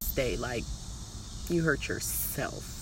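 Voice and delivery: ordinary talk, nothing marked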